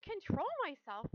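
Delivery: high, loud, incredulous; indignant yell. A narrator's reading. Girl throwing her father's words back, yelling. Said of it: "yelled Fern".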